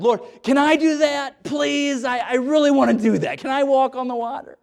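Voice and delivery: high, eager begging voice; pleading Peter. Acted. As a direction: beg like an excited kid asking permission.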